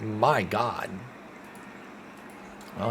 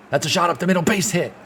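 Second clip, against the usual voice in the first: higher and louder, thrilled by the hit; excited call; 7 semitones higher, 6 dB louder, faster than usual. Real, not acted. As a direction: call the rally hit with rising joy. Marlins rally in ninth.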